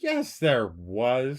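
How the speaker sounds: very drawn-out, dogged insistence; stubborn contradiction. Real, not acted. Co-host in back-and-forth over comics, stubbornly drawn out. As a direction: stretch each word stubbornly to win.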